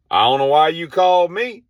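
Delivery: high, loud brush-off over the radio; radio reply. Retold or imitated. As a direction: shrug it off down the walkie-talkie. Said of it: "she says this".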